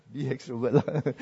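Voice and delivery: slow, soft, laughing take on the words; laughing demo. Shown as a demonstration. Pastor showing the congregation how to be extrovert, laughing. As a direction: chuckle through it as if it's absurd. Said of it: "You know how, like".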